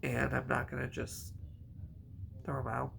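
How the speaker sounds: normal talking voice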